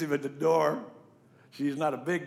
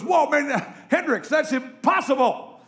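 astonished player; 12 semitones higher, 7 dB louder than usual. Retold or imitated. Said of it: "He goes".

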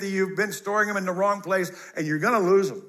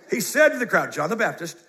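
ordinary delivery

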